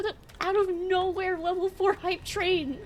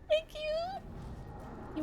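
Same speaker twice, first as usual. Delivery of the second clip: choked-up, soft thanks; near tears; 11 semitones higher, 9 dB quieter than usual. Real, not acted. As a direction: hold back tears as you speak. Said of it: "I'm trying not to cry".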